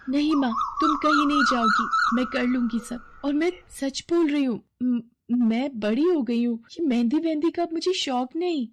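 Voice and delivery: put-on a girl hiding her wish, insisting; insistent daughter. (ऊँची आवाज़ में ज़िद करती बेटी)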